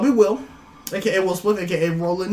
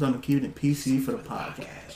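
whisper; 6 semitones lower, 8 dB quieter than usual. Real, not acted. Whispered jokes about the podcast.

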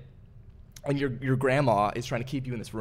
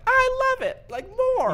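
put-on very high, squeaky little-kid voice; toddler voice; 6 dB louder, slower than usual. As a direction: squeal it like a tiny excited kid. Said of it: "three-year-olds are like".

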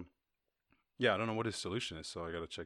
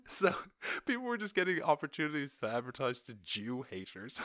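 laughing; 5 semitones higher than usual. Real, not acted. Laughing through the sentence.

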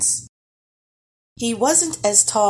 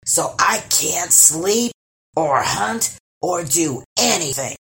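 a troll griping about his pounding heads; complaining troll; 3 dB louder than usual. Acted.

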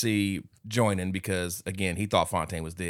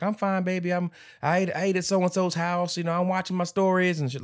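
high-pitched voice of a dismissive mother; mock motherly falsetto; imitating a mom; 10 semitones higher than usual. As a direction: put on a high mama voice. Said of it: "and she's like".

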